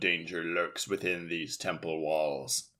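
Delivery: low, ominous announcer warning; low, dramatic radio villain; deep radio announcer voice. Retold or imitated. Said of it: "a deep but, like, radio announcer voice".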